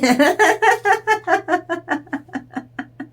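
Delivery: high, far-off giggling laugh; playful high fairy laughter; fairy giggle. Acted. Fairies scene, high far laugh.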